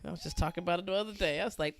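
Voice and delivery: normal talking voice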